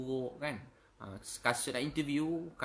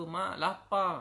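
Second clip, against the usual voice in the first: put-on higher, pleading child's voice; hungry child; 6 semitones higher, slower than usual. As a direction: whine it like a hungry little kid. Hungry child begs mother.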